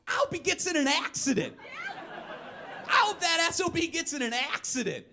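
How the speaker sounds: high, hammed-up curse at the speeder; mock spiteful yell. Driver cursing the speeder who passed, shrill mock rage. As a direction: spit out the wish in a shrill mock rant. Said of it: "you always add".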